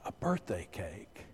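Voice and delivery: very soft, low, slow wonder; touched and moved. Acted. Woman seeing her first birthday cake, hushed and touched. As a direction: barely get it out, overcome. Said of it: "She's so touched and moved".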